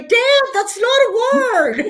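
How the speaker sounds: put-on high, laughing child's protest; a kid correcting dad, high and giggly; child self. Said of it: "I would say".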